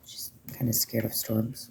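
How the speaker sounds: unvoiced, hushed aside; a secret aside in a breathy whisper. Real, not acted. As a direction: whisper it so she can't hear.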